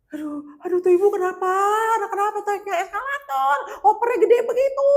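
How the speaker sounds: very high, loud mock wail; hammed-up cry of despair; imitating tearful wailing. Tour leader seeing the fallen woman, wailing in dismay. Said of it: "Dia nangis tuh di situ loh".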